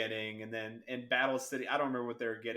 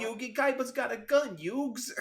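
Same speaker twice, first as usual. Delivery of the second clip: put-on Brooklyn accent; 10 semitones higher, slower than usual. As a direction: blurt it in a cartoon Brooklyn accent. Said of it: "he's got an awful accent".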